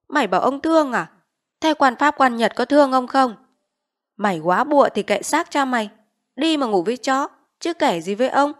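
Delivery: loud, mocking contempt; scornful chief. Village chief mocking the widow's plea, scornful. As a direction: sneer her pity away.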